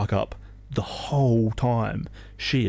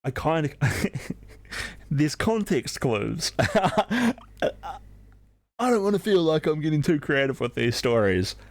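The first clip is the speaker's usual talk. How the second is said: laughing; 7 semitones higher than usual. Real, not acted. Laughing at own story.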